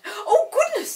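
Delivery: high, loud squeal of alarm; alarmed Molly. Acted. Molly startled as the chair flies inside, squealing. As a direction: gasp it in fright. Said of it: "said Molly in alarm".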